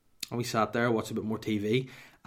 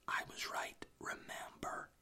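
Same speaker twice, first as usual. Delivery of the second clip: whispered smug reminder; gloating whisper; 14 dB quieter, slower than usual. Retold or imitated. Gloating to his wife that he was right, whispered. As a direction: breathe it out like a secret gloat.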